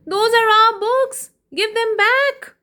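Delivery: high, loud indignant yell. Acted. Children yelling at the book thief, high and loud. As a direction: demand them back loudly. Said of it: "the children yelled".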